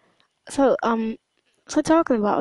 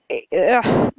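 disgusted groan; 4 dB louder than usual. Real, not acted. Disgust at the idea.

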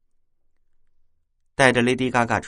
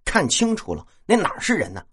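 高声训斥; 拔高嗓门数落对方; 10 semitones higher, 4 dB louder than usual. A narrator's reading. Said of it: "我呵斥他说". (厉声呵斥)

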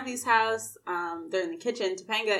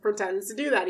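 ordinary talk

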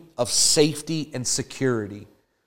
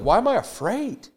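higher, wondering question; David asking himself why he is afraid; 9 semitones higher than usual. Retold or imitated. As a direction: ask it as if puzzled by the fear. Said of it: "David's like".

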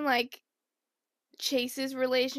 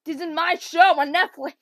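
annoyed shout, higher and louder; angry shout; 4 semitones higher, 10 dB louder than usual. Retold or imitated. John B yelling at the copycat to back off. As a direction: bark it at the copycat. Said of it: "He's like".

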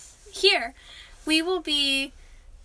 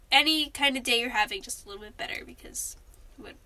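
soft sincere; 4 semitones lower, 6 dB quieter than usual. Real, not acted. Sincere message to listeners.

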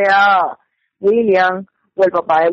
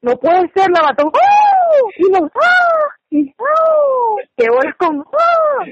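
high squealing, over-the-top gasps and cries; mock shocked disbelief; 14 semitones higher, slower than usual. Imitating herself finishing a murder mystery last night, hammed-up shock. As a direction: wail it out in exaggerated disbelief. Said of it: "estaba así igualita".

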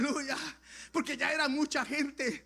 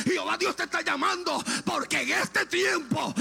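full-force preaching; 3 semitones higher, 5 dB louder than usual. Acted. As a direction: cry it out to the congregation in a ringing voice.

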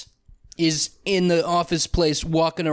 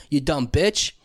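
ordinary talk

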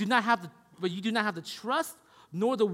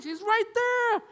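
high, loud, exasperated mother's shout; imitated screaming mom; 13 semitones higher, 6 dB louder, slower than usual. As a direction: shout up at a balking kid like his mom. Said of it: "She's, like, screaming out there".

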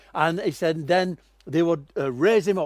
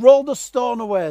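commanding call; 6 semitones higher, 7 dB louder than usual. Acted. Jesus commands at the tomb.

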